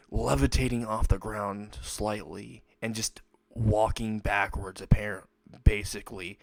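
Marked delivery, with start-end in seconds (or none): none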